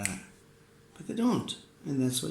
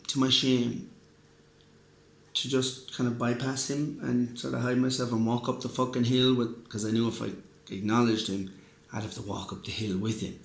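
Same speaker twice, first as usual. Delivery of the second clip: normal talking voice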